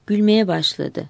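ordinary delivery

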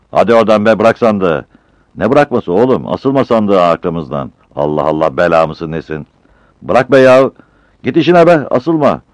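loud, high shouting match; heated quarrel. Acted. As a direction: shout each other off the crate. Porters quarrel over the crate. (bağıra çağıra kavga)

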